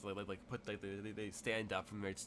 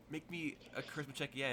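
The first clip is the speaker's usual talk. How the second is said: normal talking voice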